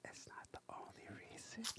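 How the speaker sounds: high airy whisper; whisper yelling. Real, not acted. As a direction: whisper it pointedly so all hear. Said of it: "repeats it whispering after teasing".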